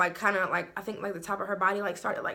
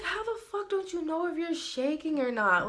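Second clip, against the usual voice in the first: much higher, disbelieving question; retold incredulous outburst; 8 semitones higher than usual. To his shaking friend, a much higher incredulous question. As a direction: squeak it out in total disbelief. Retelling exasperated reply.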